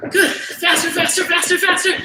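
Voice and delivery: high, loud rapid-fire repeats; urgent cheering-on. Real, not acted. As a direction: spur them on at full tilt. Coach urging on the sprint.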